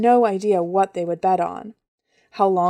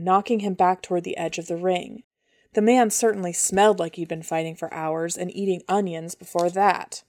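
usual speaking voice

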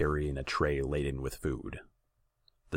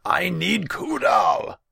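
very high, loud, stretched yell; frantic royal bellow; desperate shout; 11 dB louder, slower than usual. A narrator's reading. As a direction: scream the demand across the hall. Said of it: "he shouted".

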